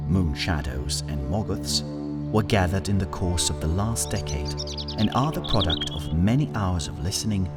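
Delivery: usual speaking voice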